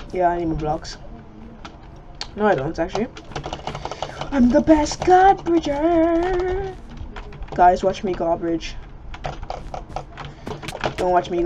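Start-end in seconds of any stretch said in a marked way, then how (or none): 4.3-6.7 high, slow, drawn-out crowing; silly boast. Pretend. Player showing off god bridging, silly boast. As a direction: crow it slowly in a high silly voice.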